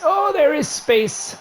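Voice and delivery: delighted surprise. Real, not acted. Gamer spotting an open lane, drawn-out delighted surprise.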